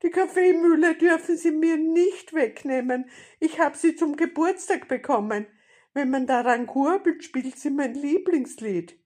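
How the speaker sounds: high, loud, urgent appeal; pleading grandmother. A narrator's reading. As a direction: plead in a high old lady's voice. Grandmother pleads to keep mill.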